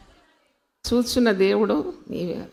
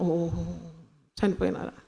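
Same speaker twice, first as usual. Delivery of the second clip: low, hushed mock sympathy; token condolence; 5 semitones lower, 9 dB quieter than usual. Preacher imitating visitors at funerals, soft token condolence. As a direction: drop the voice into a funeral murmur.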